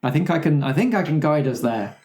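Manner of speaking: ordinary talk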